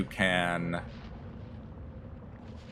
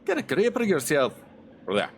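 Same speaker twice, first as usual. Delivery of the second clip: put-on Scottish accent; 7 semitones higher than usual. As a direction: scold him hard in a Scottish accent. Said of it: "please forgive my Scottish".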